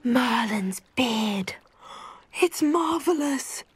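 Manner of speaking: slow breathless whisper of wonder; awed whisper. Acted. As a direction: whisper it as if you can't believe your eyes. Awed at the returned gift.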